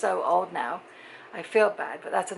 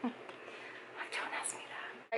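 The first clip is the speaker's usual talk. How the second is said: breathy whispered aside, much softer; 4 semitones higher, 15 dB quieter, much slower than usual. Real, not acted. Muttered aside to viewers.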